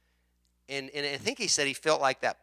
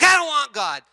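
louder, higher defiant line; mock angry refusal; 9 semitones higher, 8 dB louder than usual. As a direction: spit it out stubbornly. Voicing angry people.

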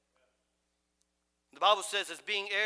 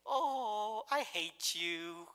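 loving singsong over unkind words; sweet tender tone, harsh words; 2 semitones lower, 3 dB quieter, much slower than usual. Shown as a demonstration. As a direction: say hate softly and slowly like a lullaby. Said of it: "And they'll smile".

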